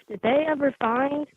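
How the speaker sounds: ordinary delivery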